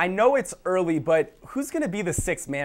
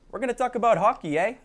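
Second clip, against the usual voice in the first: mock Canadian accent, higher and quicker; terrible Canadian accent; 5 semitones higher, 3 dB louder, faster than usual. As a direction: badly fake a Canadian hockey accent. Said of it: "That was a terrible accent".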